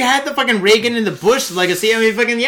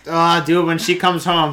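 ordinary delivery

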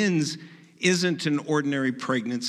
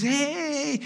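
high-pitched drawn-out party cheer; mock cheering; 13 semitones higher than usual. Guests cheering at a twins reveal, mock excitement. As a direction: imitate a delighted crowd, pitched way up. Imitates crowd at gender reveal.

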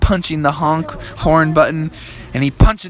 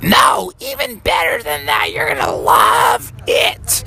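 high, slow falsetto mom; excited mom; 5 semitones higher, slower than usual. Acted. Mom teases Froggy with the news, high excited voice. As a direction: stretch it out in a high mother voice.

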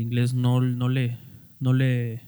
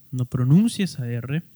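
normal talking voice

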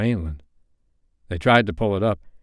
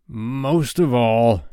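slow, drawn-out passion; passionate; 3 semitones higher, 4 dB louder, much slower than usual. A narrator's reading. Hook confiding in his bosun, slow and passionate. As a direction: stretch the words with intensity. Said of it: "Hook was saying passionately".

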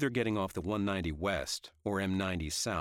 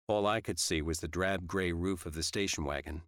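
ordinary delivery

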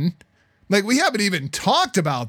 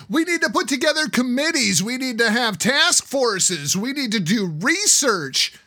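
mocking bureaucrats; 6 semitones higher than usual. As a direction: parody government committee talk, hammed-up. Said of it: "That's their solution".

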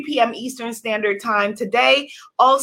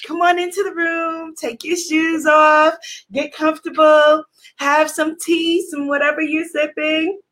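high, bright-energy greeting to the room; upbeat host welcome; 4 semitones higher than usual. Acted. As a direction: welcome everyone like a party host. Hosts an upbeat live welcome.

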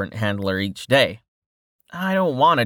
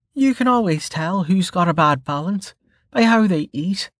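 put-on higher, dry teasing young woman; Melka voice; 9 semitones higher than usual. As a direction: tease him in a higher voice. Melka's voice in the story.

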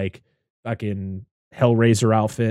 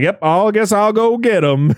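hammed-up agreeable dad voice; oblivious dad; 9 semitones higher, 6 dB louder than usual. Dad agreeing to go get him, oblivious. Acts out dad's oblivious reply.

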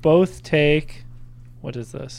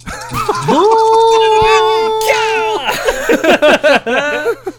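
shrieking high laughter; loud burst of laughter; 7 dB louder than usual. Real, not acted. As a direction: howl with delight at the Fireball. Table erupts at Fireball.

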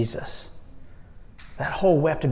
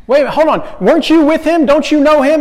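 much higher, louder, pressing questions; incredulous challenge; 11 semitones higher, 8 dB louder, faster than usual. Acted. Bystander cornering Peter, loud and incredulous. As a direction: pounce on him in disbelief.

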